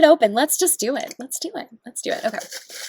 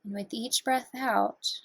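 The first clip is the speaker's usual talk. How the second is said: guided meditation; 5 semitones lower, 3 dB quieter than usual. Acted. Guiding viewers through breathing, in a calm meditation voice. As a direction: talk them gently through each breath.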